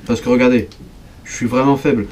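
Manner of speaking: ordinary delivery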